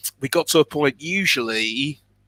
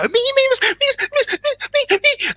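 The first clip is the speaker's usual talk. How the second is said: put-on very high childish begging; children in class beg to read, in very high squeals; eager kids begging; 3 dB louder than usual. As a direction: squeal like a kid desperate to be picked.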